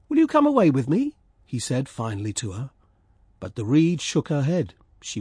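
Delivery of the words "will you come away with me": high, louder swallow asking; swallow pleading. Acted. Swallow asking the reed to leave, high and loud. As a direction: call it out high and hopeful.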